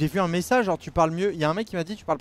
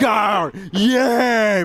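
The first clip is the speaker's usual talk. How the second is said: hyped-up yell, louder and higher; excited shout; 5 semitones higher, 9 dB louder, much slower than usual. Real, not acted. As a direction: shout it out like a race fan. Hype shout while racing. (cri de joie pendant la course)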